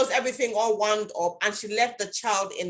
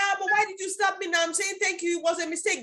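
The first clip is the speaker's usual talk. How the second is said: protesting woman; 9 semitones higher than usual. Retold or imitated. Slapped woman protests, voiced high.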